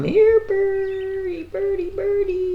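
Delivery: high sing-song bird call; coaxing a wild bird. Real, not acted. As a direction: coo high to lure the bird.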